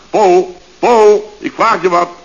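luider en geërgerd, hij wordt kwaad; ongeduldig roepen. Acted. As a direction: snauw de naam Pol twee keer. Chef verliest geduld met Pol. (chef roept Pol ongeduldig tot de orde, luid)

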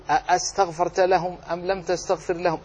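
ordinary talk

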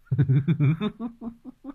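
high rising evil cackle; maniacal laugh. Acted. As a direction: cackle like a mad butcher. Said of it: "tên đồ tể ngồi cười hành hạch".

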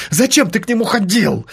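sharp, high accusing blurt. Acted. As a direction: fire the question off at him. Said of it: "выпалила она с порога".

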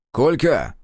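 calling out. Acted. Зотов окликает Кольку.

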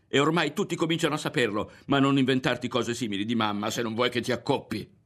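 high, loud and heated throughout; an outraged boy defending his mother. Acted. Berto rages to his friend about the girl's lie. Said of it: "Aveva alzato la voce anche adesso ed era acceso".

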